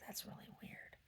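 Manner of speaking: hushed whisper. Real, not acted. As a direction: whisper it in the shop. Whispered remark in shop.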